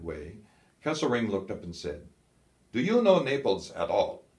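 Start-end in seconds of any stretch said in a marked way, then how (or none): 2.7-4.2 field marshal's question. A narrator's reading. Kesselring questions Scholl.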